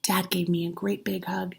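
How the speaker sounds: ordinary delivery, nothing marked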